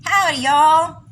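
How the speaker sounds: high, loud mock Texan greeting; hollered cartoon Texas accent. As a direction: put on a fake Texas twang. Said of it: "It's kinda like speaking Texas".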